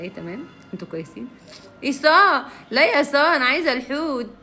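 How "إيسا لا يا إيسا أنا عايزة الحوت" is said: loud, high calling out; loud demand. Real, not acted. Streamer loudly demanding from a viewer. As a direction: call out to her and insist.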